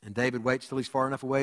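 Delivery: ordinary talk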